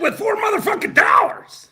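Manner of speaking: mock shrill shout. Streamer mimicking a donor's tiny tip, high mock shout. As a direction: shout it like an outraged woman. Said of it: "And she said".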